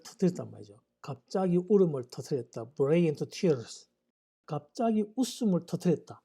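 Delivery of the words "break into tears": very slow, careful English sentence; slow example reading. Shown as a demonstration. English teacher reading an example sentence slowly to students. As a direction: read each English word slowly for learners.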